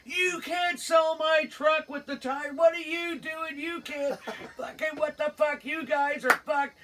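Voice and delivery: high-pitched outraged rant; Tony freaking out. Retold or imitated. Storyteller voicing an angry caller whose truck is for sale, freaking out. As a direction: imitate a frantic, disbelieving phone tirade. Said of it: "Larry gets a call from Tony ... Freaking out".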